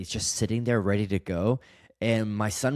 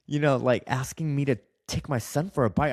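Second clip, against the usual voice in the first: ordinary talk, nothing marked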